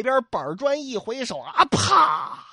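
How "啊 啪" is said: sharp, loud "pah" of impact; loud smack imitation. Storyteller acts out the brick hitting, loud smack. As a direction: voice the blow with a burst.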